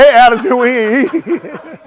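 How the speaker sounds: loud mock shout that collapses into a slow laugh; loud imitation of Haywood that melts into laughter. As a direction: ham up Haywood, loud, then laughing slowly through it. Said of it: "He said".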